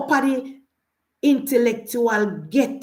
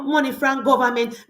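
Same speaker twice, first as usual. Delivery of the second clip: ordinary delivery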